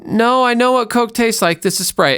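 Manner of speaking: high-pitched, adamant objection; insistent protest. Acted. Someone disputing which drink is in the cup, insistent. Acted gaslighting argument over a drink.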